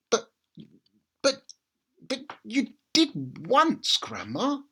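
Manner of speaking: put-on dutiful small boy answering; little boy's voice. A narrator's reading. George stammers to grandma.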